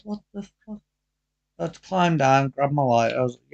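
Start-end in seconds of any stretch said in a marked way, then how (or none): 0.0-0.8 baffled. Retold or imitated. Baffled reaction to strange woman.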